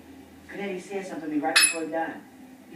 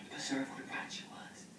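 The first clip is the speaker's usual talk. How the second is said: whispering; 4 semitones lower, 13 dB quieter than usual. Real, not acted. Quiet aside to friend.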